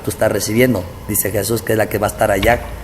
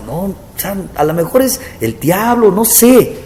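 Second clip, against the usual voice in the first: no no no, sure of himself, pitched way up; incredulous denial; 6 semitones higher than usual. Retold or imitated. Pastor telling God his flock loves him, high incredulous protest.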